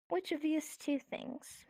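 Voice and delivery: slow, drawn-out weighing of two options; slow musing. Shown as a demonstration. Teacher slowly weighs two things for the class, musing. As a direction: draw the question out slowly.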